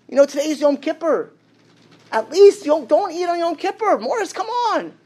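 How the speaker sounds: high-pitched, urgent remonstrance; exasperated retold appeal; imploring. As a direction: plead with a friend, voice climbing high. Retold appeal to stop eating.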